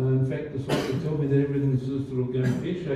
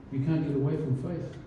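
ordinary delivery

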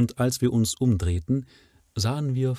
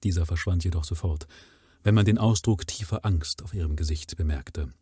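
ordinary talk, nothing marked

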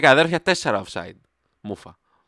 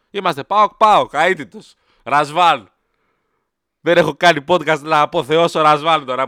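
loud mock rant; 5 semitones higher, 10 dB louder than usual.

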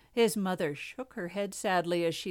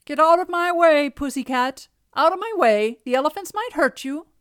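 loud, higher-pitched royal order; calling out; 7 semitones higher, 10 dB louder than usual. A narrator's reading. Newly married king warns the cat, calling out loudly. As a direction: shout a warning down the road.

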